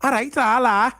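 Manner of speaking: high, lightly put-on Liverpool "la"; a quick Scouse bit on request; Scouse accent. Fan asked for a Scouse accent, lightly done Scouse. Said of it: "can you do a Scouse accent?".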